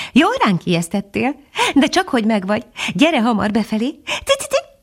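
high chirpy little bird; a small bird fussing happily; titmouse voice. A narrator's reading. The titmouse keeps talking.

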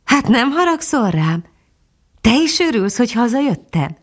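high, wondering questions; exclaimed. A narrator's reading. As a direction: pitch lifted in mild astonishment. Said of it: "kiáltott fel Füstös".

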